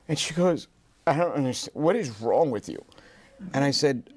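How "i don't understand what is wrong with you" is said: higher, mock-upset imitation; retold tearful wife. Wife reacting to husband quitting his job, mock tearful. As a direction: imitate a wife starting to cry. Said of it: "she broke down in tears".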